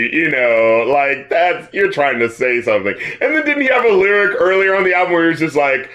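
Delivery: usual speaking voice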